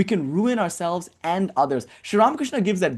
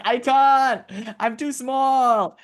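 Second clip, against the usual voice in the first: whiny snake; 9 semitones higher, much slower than usual. Retold or imitated. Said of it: "And the snake is like".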